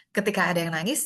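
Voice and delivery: ordinary delivery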